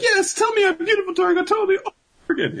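very high falsetto squeal; gushing bride. Acted. Bride begging Toriko for compliments, gushing falsetto. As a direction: plead for praise in a put-on squeaky voice.

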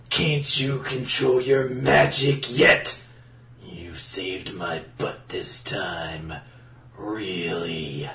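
low whispered gravel, slow and menacing; Clint Eastwood voice. Acted. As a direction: growl it low like a cowboy gunslinger. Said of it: "he's now Clint Eastwood".

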